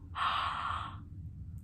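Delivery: a drawn-out, soft gasp of disbelief; replaying the moment she gasped; long gasp. Reliving his confession, a long quiet gasp. Retelling her shock at confession.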